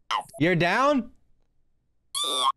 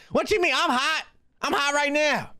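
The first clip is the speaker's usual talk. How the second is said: much higher, playful sass; high mock sassy boast; 10 semitones higher than usual. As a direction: squeal it like a diva. Playful boast about being hot.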